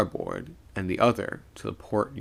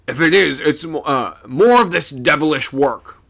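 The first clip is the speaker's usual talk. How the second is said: loud worried sailor's voice; a rough mate calling up the rigging; second mate voice; 6 semitones higher, 9 dB louder than usual. A narrator's reading.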